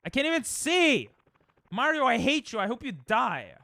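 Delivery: high, loud raging yell; frustrated rage. Real, not acted. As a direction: scream your hatred at the game. Raging at the game.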